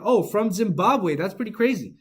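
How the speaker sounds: usual speaking voice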